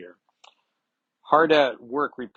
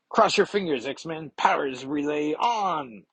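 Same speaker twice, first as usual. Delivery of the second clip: higher, slowing build to the switch-on; dramatic announcement; 5 semitones higher than usual. Acted. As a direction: announce the big moment, stretching the end. Nightcrawler announces switching machine on.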